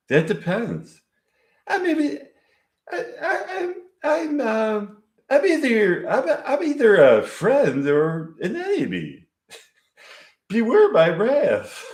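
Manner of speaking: mock-menacing giggle way up high; Maud's voice. Maud warns the couple before leaving, high giggly character voice. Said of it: "Okay, this is Maud's voice".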